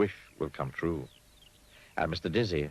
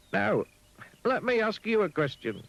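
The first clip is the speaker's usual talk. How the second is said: high and brightly sure; high Mister Dizzy; 4 dB louder than usual. Acted. Mister Dizzy answers the pig, high and sure. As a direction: answer with easy confidence.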